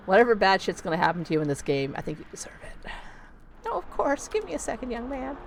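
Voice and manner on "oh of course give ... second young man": high granny voice, easing down; put-on high old-lady voice; obliging old lady. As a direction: answer as an accommodating old woman. Old tenant agrees to coffee.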